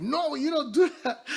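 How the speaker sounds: high, loud scolding with a laugh; strict auntie scolding. Retold or imitated. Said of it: "It's like".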